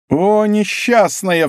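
high, long-drawn exclamation; crying out. A narrator's reading. Commissioner reading the letter, crying out. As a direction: stretch out the dismayed cry. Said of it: "вскричал комиссар".

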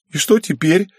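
put-on high, whiny sniffly girl; acted weepy little-girl voice; tearful. A narrator's reading. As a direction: ask it high, close to tears. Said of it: "вновь зашмыгала носом означенная девочка".

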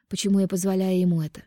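ordinary delivery, nothing marked

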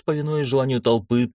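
ordinary delivery

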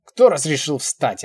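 loud, high, slow demand; angry yell. A narrator's reading. Old man yells at her for rising, angry. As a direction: shout her down. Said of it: "орёт он на меня".

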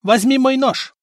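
high-pitched call; urgent shout. A narrator's reading. Stefan to his brother in battle, high shout. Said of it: "крикнул Стефан".

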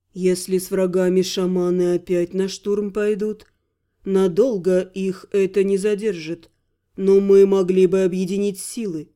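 low, smooth, courtly voice; incubus voice. Acted. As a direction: speak like a suave demon.

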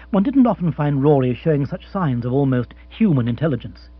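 ordinary delivery, nothing marked

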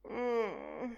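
faint, sleepy hum of a grunt. Acted. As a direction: grunt from half asleep. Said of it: "Un debole grugnito assonnato". (la moglie mugugna assonnata dal letto)

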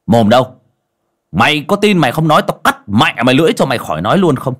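loud, fast scolding; shouting. Acted. Master scolding the possessed man, loud fast shout. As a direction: bark the threat fast at the ghost. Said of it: "mà quát".

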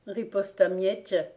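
ordinary delivery

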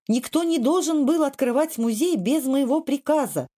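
high-pitched outraged protest; mild yell. A narrator's reading. Kvardli objecting that the museum was opened, a high mild yell. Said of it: "завопил штандартенфактор".

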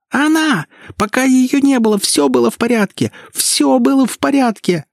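high hysterical shriek. Acted. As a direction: scream it, way up high. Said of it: "визжала она".

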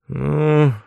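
high, drawn-out «Ну»; lingering, non-committal «Ну». A narrator's reading. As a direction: stretch «Ну» out thoughtfully. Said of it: "протянул мой учитель".

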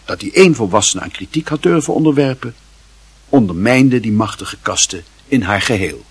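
usual speaking voice

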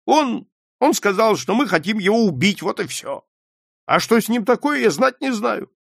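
high, exasperated self-defence; desperate shout. A narrator's reading. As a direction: protest loudly to your father. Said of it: "в отчаянии крикнул Джонни". (кричать в отчаянии, оправдываясь)

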